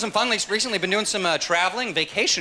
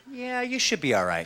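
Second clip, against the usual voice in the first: higher, drawn-out hedging "yeah"; unsure doctor; 5 semitones higher, 4 dB quieter than usual. Retold or imitated. Doctor reassuring traveller, drawn-out and doubtful. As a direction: stretch it out, unconvinced. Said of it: "my doctor looks at me".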